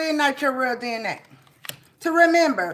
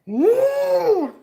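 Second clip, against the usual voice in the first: high whoop; 11 semitones higher than usual. Real, not acted. Celebratory whoop.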